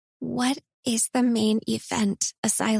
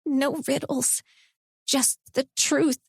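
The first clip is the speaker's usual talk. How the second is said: shaky voice; 5 semitones higher than usual. A narrator's reading. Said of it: "I said in a shaky voice".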